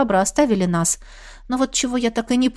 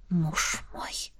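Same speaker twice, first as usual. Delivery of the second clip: hushed, breathed low words; breathy whisper; 6 semitones lower, 12 dB quieter than usual. A narrator's reading. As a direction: breathe it against his lips. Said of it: "выдохнула ему в губы".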